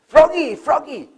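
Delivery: high, loud call up the pole. Acted. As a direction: shout his name to the top. Crowd calling up to frog.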